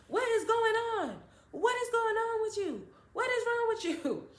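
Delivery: imitating a panicked mother; panicked mom. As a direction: squeal it like a frightened mom.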